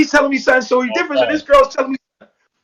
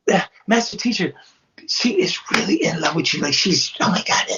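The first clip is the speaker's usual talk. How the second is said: secret whisper; 3 semitones lower, 4 dB quieter than usual. Retold or imitated. Serenity secretly passing the message.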